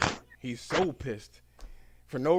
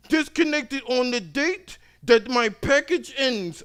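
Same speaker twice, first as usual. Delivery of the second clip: higher, louder, slow demand; higher slow insistence; demanding customer; 12 semitones higher, 9 dB louder, slower than usual. Retold or imitated. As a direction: insist on it firmly.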